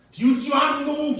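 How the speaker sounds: hammed-up fake anger; mock threat. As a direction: pretend to scold the audience. Mock threat to mocking viewers.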